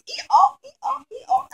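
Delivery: high hee-haw bray; donkey bray. Pretend. Teacher showing the children the donkey, high bray.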